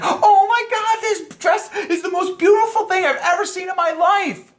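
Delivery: very high, hammed-up female squeal; going crazy with excitement. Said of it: "she might go crazy".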